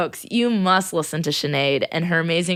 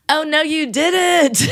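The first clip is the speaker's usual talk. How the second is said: drawn-out, higher, louder shocked reply; mock shocked; 9 semitones higher, 4 dB louder, slower than usual. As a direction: act stunned, slowly and loud. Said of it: "And I was like".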